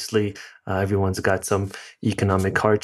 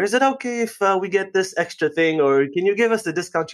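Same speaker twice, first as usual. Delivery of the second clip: imitating a posh English accent, pitched much higher; 10 semitones higher than usual. British friend asks the waiter for a discount, in a posh English accent. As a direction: ask for a discount with mock posh English politeness. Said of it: "with his posh English accent".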